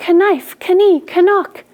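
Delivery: knife, kani, canuck with a loud K-uh before the N; K wrongly sounded. Shown as a demonstration. Teacher showing the mistake, knife, kani, canuck said with a sounded K. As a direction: sound out the K: "kuh-nife", "kuh-nee", "kuh-nock". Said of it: "you're going to accidentally say".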